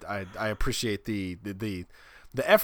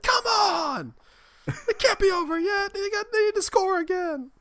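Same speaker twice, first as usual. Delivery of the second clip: high-pitched, louder mock outburst; frantic protest; 5 dB louder than usual. Parent hurling daughter across the gym, frantic high protest. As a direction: wail it sky-high in mock outrage.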